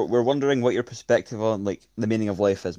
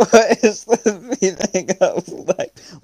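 high, slow, loud mock sobbing; hammed-up wailing through laughter; fake crying; 8 semitones higher, 5 dB louder, much slower than usual. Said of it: "Pretend to cry while you do it".

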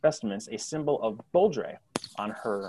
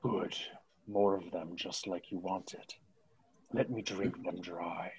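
Blood's voice; 3 semitones lower, 8 dB quieter than usual. Acted. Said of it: "Blood's voice say".

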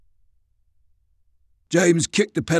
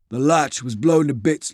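ordinary delivery, nothing marked

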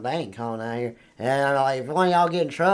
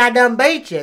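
retold triumphant boast; 6 semitones higher, 6 dB louder than usual. Retold triumph in a religious argument.

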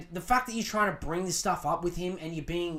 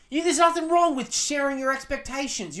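usual speaking voice

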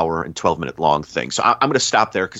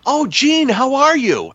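much higher, sing-song falsetto greeting; mock chummy greeting; 4 dB louder, slower than usual. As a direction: gush a friendly hello in a high falsetto. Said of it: "he sort of does that thing where it's like".

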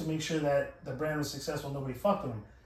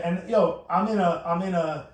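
ordinary delivery

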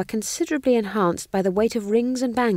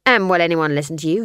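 sneering taunt; 6 dB louder, faster than usual. Acted. Guard taunting a captive.

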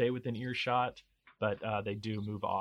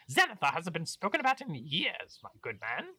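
pompous baron voice; 4 semitones higher, 8 dB louder than usual. Acted.

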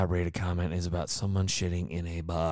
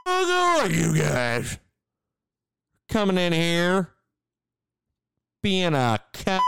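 mock angry rant; 10 semitones higher than usual. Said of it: "I'd be like".

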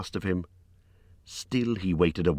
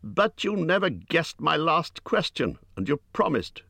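raised-pitch, insistent objection; indignant protest; 11 semitones higher than usual. A narrator's reading. Hobbit holds the creature to his promise, indignant.

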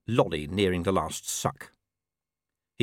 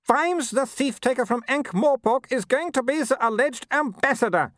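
high sharp haughty snap; snapped; 4 dB louder than usual. A narrator's reading. Baroness snapping at her husband, high sharp snap. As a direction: bite the words off, impatient. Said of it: "snapped the Baroness".

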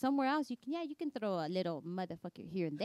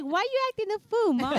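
put-on higher, louder sassy kid voice; mock kid; 12 semitones higher, 10 dB louder than usual. Mother imitating her daughter scolding her, high and loud. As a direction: sass mom like a cheeky daughter. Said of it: "Julia's like".

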